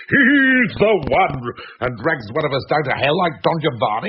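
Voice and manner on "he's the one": slow, drawn-out mock doom voice, a bit louder; hammed-up booming verdict from beyond; sepulchral booming voice. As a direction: intone it like a ghostly judge. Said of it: "till a sepulchral voice booms out".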